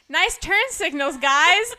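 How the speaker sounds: loud sarcastic jab; mock the driver loudly. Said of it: "she goes".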